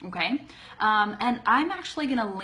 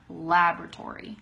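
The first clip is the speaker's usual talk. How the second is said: "laboratory" as "LAB-ruh-tory"; "laboratory" with strong first syllable, first O dropped; front stress; 3 semitones lower than usual. Shown as a demonstration. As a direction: put the emphasis on LAB in "laboratory". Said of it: "emphasis is right there in the front".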